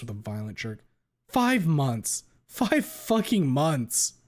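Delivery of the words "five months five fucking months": higher, louder furious shout, slow and hammered. Acted. Anna raging at herself in front of the therapist, shouting. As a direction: yell each word out in rage. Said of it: "I shout with a stomp".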